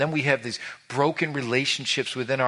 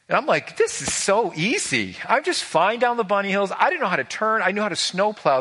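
higher, eager telling of racing down; cocky excitement, pitched up; excited retelling; 8 semitones higher than usual. Retold or imitated. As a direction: relive the thrill of flying downhill. Retelling overconfident skiing.

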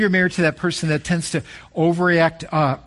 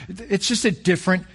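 ordinary talk

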